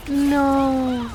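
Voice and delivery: loud high cry of dismay; dismayed shout. Real, not acted. Losing in game.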